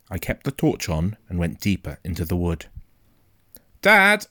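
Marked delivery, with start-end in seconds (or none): 3.8-4.3 high, loud call into the dark; shout. A narrator's reading. Boy calling for his father in the wood, shouting. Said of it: "I shouted".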